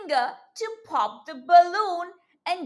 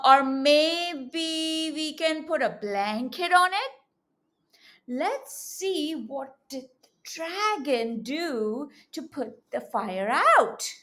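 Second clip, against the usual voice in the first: ordinary delivery, nothing marked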